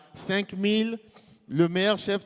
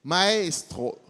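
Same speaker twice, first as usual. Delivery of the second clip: ordinary delivery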